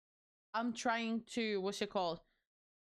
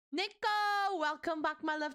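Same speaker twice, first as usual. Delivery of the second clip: excited greeting; 6 semitones higher, 3 dB louder than usual. Real, not acted. Greeting new viewer excitedly.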